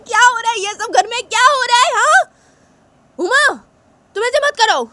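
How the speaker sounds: very high, loud shrill mother; frantic mother. Acted. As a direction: break up the fight in panic. Mother yelling at kids. (बहुत ऊँची, घबराई माँ की आवाज़)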